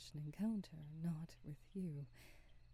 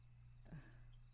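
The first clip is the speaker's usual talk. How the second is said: soft sigh; 14 dB quieter than usual. Acted. Sigh after recalling her lost love.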